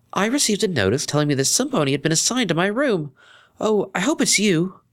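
put-on high, proper young mare voice; polite friend over the phone; Octavia.

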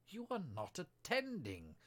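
slow, high, emphatic rebuke; severe. A narrator's reading. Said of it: "said the mouse to Alice severely".

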